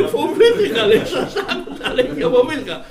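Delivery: high loud laughing retelling of fear; mock the captain's fright while laughing; laughing quote. Monk quoting the scared captain, laughing.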